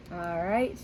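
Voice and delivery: ordinary delivery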